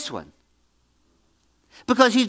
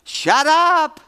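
raised, higher-pitched command; emphatic rebuke; 6 semitones higher, 4 dB louder than usual. Acted. Preacher answering people who moan about problems, emphatic rebuke. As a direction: cut off the whiner with a forceful order. Preacher cuts off the mock complainer.

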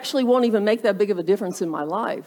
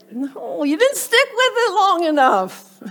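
much higher, laughing rebuke; comic protest; 8 semitones higher than usual. Acted. Preacher answers couch-bound quitter, comic protest. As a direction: exclaim it in playful exasperation.

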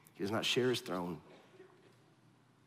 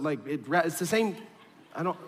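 normal talking voice

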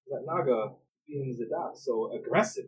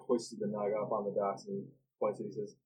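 ordinary talk, nothing marked

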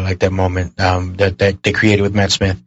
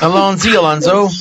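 high, louder mock shout of the catchphrase; Doctor's shouted catchphrase; 10 semitones higher, 3 dB louder than usual. Imitating the Doctor for his podcast cohosts, high mock shout. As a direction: belt it out like the Doctor charging off. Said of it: "Tenet would shout".

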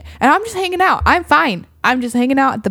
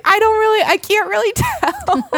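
high, drawn-out, unsure voice; high and slow, half laughing at herself; unsure squeak; 12 semitones higher than usual. Retold or imitated. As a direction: squeak it out like you truly can't tell. Unsure voice in retold fishing moment.

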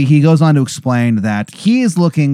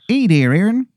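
higher, hammed-up Canadian accent; Angel of Canada, Canadian accent; 8 semitones higher than usual. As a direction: greet in a bad Canadian accent, pitched up. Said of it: "it is a horrible Canadian accent".

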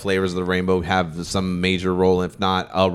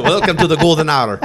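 laughing intro; 6 semitones higher, 6 dB louder than usual. Real, not acted. Restarting the show intro.

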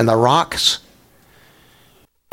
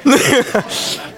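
high burst of real laughter; 11 semitones higher than usual. Real, not acted. Pastor laughing while telling the congregation about his dog. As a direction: break up laughing at the memory.

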